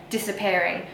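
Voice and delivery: normal talking voice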